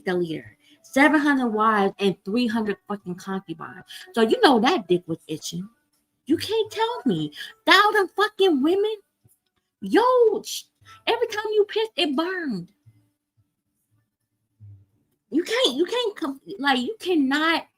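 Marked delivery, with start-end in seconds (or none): 6.3-17.7 worked-up rant. Real, not acted. Ranting about Solomon's thousand wives.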